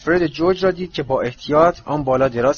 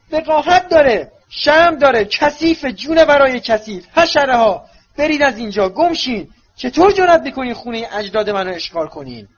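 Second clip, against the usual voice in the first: much higher, louder shrieking hag; screeching old portrait; 11 semitones higher, 5 dB louder than usual. Acted. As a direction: screech in outrage like a mad old woman. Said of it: "پیرزن بلندتر جیر کشید". (جیغ گوشخراش پیرزن خشمگین)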